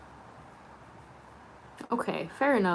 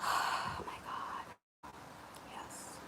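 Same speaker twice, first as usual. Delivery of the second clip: whisper; 18 dB quieter, much slower than usual. Real, not acted. Savouring soup, whispered.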